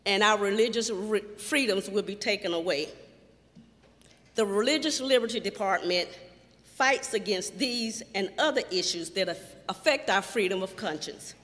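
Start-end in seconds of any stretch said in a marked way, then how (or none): none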